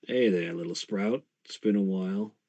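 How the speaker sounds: low, soft voice of a roguish ghost; ghostly uncle. Acted. Ghost uncle greets his little sprout, low and soft. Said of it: "you hear a voice that goes".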